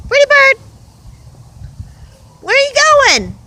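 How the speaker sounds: high, bright sing-song voice for the bird; pet talk. Acted. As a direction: coo at the bird like a baby. Calling the bird.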